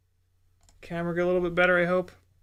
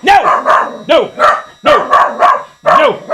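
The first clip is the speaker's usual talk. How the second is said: loud, high repeated no; shouting no at Teddy; sharp shouted no; 10 semitones higher, 13 dB louder, much slower than usual. Real, not acted. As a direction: snap a loud no at the dog. Stopping the dog.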